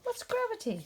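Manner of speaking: high, wide-eyed bear voice; lightly voiced curious bear. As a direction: ask it like a curious child.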